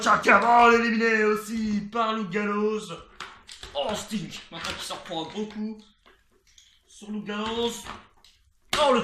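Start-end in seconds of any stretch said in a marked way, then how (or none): 0.0-2.9 excited call. Real, not acted. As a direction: ride the moment. Elimination called live.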